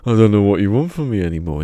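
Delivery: hammed-up angry blare; really angry retake. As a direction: imitate a bad actor blowing up on his line. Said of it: "getting really angry".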